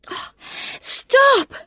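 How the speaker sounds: high, loud exasperated cry. A narrator's reading. Hu tells friends to stop searching, exasperated and loud. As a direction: throw up your hands. Hu fed up.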